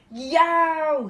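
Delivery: loud, high yelp of pain; Daddy Bear stung, shouting; big shout. A narrator's reading. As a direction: yell out as the bee stings. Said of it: "he suddenly shouted in his great big voice".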